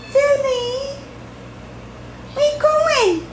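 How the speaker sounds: high cooing voice to a kitten; squeaky sing-song pet talk. Real, not acted. Calling the cat in baby talk.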